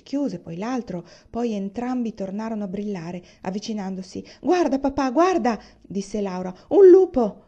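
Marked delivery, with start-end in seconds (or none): 4.4-5.6 put-on louder and higher, urgent child's cry; excited call. Laura calls Papa to the glowing eyes, loud excited cry. 6.7-7.4 put-on a loud, high child's scream of alarm; frightened shout. Laura screams to Papa that it's a wolf, high shout.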